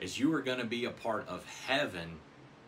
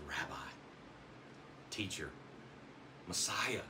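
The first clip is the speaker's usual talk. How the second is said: awed hush; 5 semitones lower, 10 dB quieter than usual. Acted. Nathanael awestruck before Jesus.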